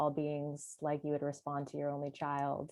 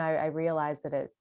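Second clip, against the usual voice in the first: ordinary talk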